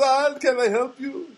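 high, drawn-out mock foreign-girl voice; put-on high-pitched foreign girl; foreign shopgirl. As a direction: imitate a foreign counter girl, loud and slow. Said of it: "She's kind of a foreign kind of girl".

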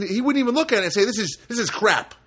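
loud, high dismissal of the work; tyrant's rejection. Retold or imitated. Boss rejecting his team's work, mock tyrant outburst.

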